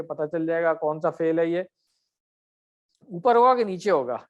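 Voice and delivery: ordinary talk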